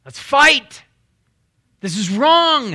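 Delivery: loud, high battle cry; rally the troops, ready to fight; fired-up shout. Retold or imitated. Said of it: "I'm a little bit more like David".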